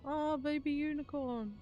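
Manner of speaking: normal talking voice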